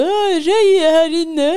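high, loud, drawn-out call; excited shout. Retold or imitated. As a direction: call out across the water, thrilled. Retold shout across lake.

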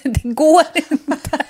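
slow, laughing through it. Real, not acted. Refusing to break her runstreak, laughing. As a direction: laugh while insisting.

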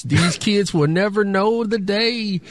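put-on old man voice. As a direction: grumble like a grumpy old man. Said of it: "in my old man voice".

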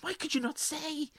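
high, soft mock-plaintive voice; upset mother. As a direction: plead in a small high voice, as a joke's mother. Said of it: "She goes".

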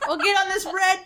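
high, laughing mock concern; mock caring voice. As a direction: play a fussy caretaker through laughter.

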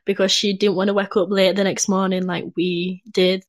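ordinary delivery

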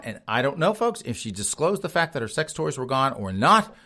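ordinary talk

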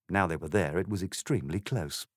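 plain reading voice

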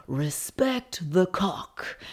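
slow, put-on bad American accent; terrible American accent. Imitating Tom Cruise's character in a bad American accent. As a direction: drag it out in a clumsy American accent. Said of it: "excuse my terrible American accent".

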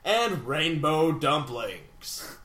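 slow names with big swooping pitch; over-the-top mock-epic roll call; epic announcer. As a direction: draw out each name as if crowning champions. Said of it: "That was way too epic".

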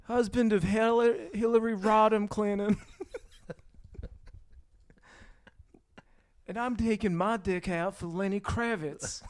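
Bill Clinton. Acted. Politician introducing himself, poor light impression. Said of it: "does not even sound like Bill Clinton".

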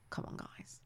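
barely audible whisper; mock-exasperated whisper under the breath; whispered aside. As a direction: whisper it as a private complaint. Imagined weary aside to the show.

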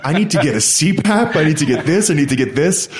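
mock panicked mom. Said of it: "she's like".

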